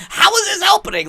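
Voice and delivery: high, loud mock bewilderment; baffled imitation. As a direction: exclaim it as if totally lost. Acting out Toby's baffled reaction.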